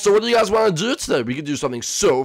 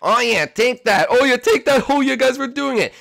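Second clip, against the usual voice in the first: gleeful mischief; 7 semitones higher, 5 dB louder than usual. Acted. Boys egging Boom Boom's house.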